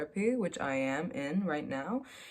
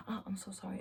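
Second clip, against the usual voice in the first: soft apologetic therapist; 2 semitones higher, 13 dB quieter, faster than usual. Retold or imitated. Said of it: "she's like".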